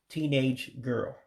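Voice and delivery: ordinary delivery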